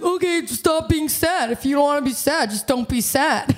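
lightly mocking her husband's matter-of-fact advice; dismissive husband. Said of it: "He's such a man".

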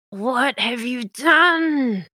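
slow, high, horrified question; a woman aghast at what they did; horrified gasp. Acted. Stunned woman asks the intruders in horror. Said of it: "looks at you horrified".

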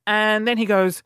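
usual speaking voice

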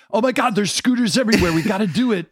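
giddy high sing-song rush; excited. Retold or imitated. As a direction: gush about the scooters, fast and high. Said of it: "come back and go".

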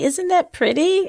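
slow, high, mock-admiring; sing-song admiring. Mocking just hanging stockings.

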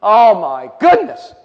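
slow, loud amazement; awestruck. Acted. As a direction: stretch it out, overwhelmed by the sight. John's awe at seeing Jesus.

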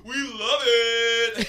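high, loud, long-drawn cheer; hammed-up delight, stretching the words; mock enthusiasm. Cheering pricey California life, sarcastic gleeful whoop.

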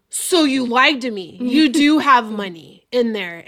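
loud, drawn-out mock accusation; slowly and loudly catch them in a lie; accusing cop. Acting the cop's accusation.

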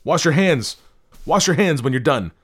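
high, loud frantic command; frantic high-pitched yelling; shouted order. A narrator's reading. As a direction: shout orders in germ panic. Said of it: "he shouted at her".

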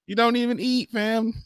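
high, soft comic disbelief; hushed high-pitched mock exasperation; incredulous mock protest. Acted quarrel with the doll.

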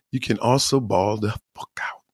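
hushed conspiratorial whisper. Real, not acted. Comedian telling listeners a secret, whispered. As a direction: lean in and whisper it.

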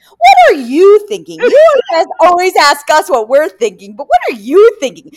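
loud, high mock outburst; exasperated retort. Housemate fed up with being asked, exasperated retort. Mimics housemate snapping back at questioner.

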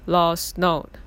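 normal talking voice

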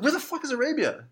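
mock disbelief. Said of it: "I'm like".

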